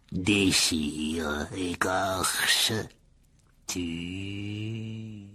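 drawn-out sinister hiss of menace; cold deadly voice. A narrator's reading. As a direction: breathe each word slowly, murderous. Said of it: "même voix froide et mortelle". (voix froide et mortelle)

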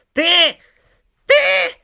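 loud, very high-pitched outburst; sputtering rage. A narrator's reading. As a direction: splutter furiously, each word a squeal.

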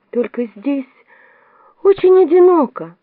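high, plaintive lament; sad. A narrator's reading. Alice confides her loneliness to the Queen, sadly. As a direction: let loneliness well up, voice lifting high. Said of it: "с грустью промолвила Алиса".

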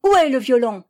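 shrill demand. A narrator's reading. Girl interrogates the woman about the violin, shrill. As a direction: demand an answer in a screech. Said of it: "d'une voix criarde et très aiguë".

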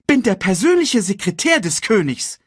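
high, loud, self-important announcement; pompous character voice. A narrator's reading. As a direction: declare your title high and loud. Character announces his royal title.

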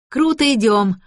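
put-on girl cheering on the effort; pleased, excited exclamation; delighted. A narrator's reading. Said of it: "обрадовалась Лота".

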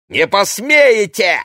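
very high, drawn-out angry shout; spiteful shout. A narrator's reading. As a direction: yell it in defiance. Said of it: "злобно крикнула Ксения".

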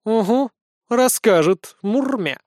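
put-on high, purring cat-girl voice. A narrator's reading. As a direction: purr it with sly doubt. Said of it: "промурлыкала Устинья".

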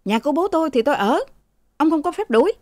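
high-pitched quick retort; acted indignant refusal; defiant arguing. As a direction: talk back to the servant. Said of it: "Thằng Mão cãi".

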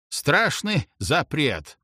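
plain reading voice

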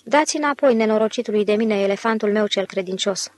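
ordinary delivery

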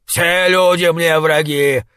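громко, медленно, в ярости; выкрикни, топая ногами; гневный топот. A narrator's reading. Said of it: "затопал ногами толстяк". (гневный выкрик)

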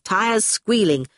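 ordinary delivery, nothing marked

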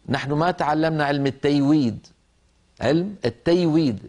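"التيويد" with y instead of j; "التيويد" for tajwid, the j softened to y; jim said as ya. Retold or imitated. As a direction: quote a Hadrami speaker, y for j in "التيويد". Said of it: "بالياء قالها".

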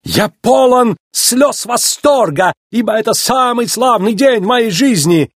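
high, drawn-out theatrical rapture; operatic, exultant shopkeeper; ecstatic Italian. A narrator's reading. Luigi overwhelmed with delight.

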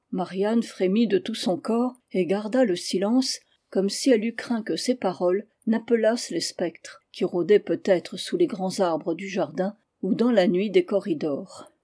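ordinary delivery, nothing marked

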